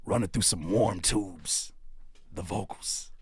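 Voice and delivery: low, slow, soft mock guru voice; mock sage. Host joking about mix tips in a mock wise-man voice. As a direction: hand down the mix advice like a prophet. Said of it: "says the Enlightening".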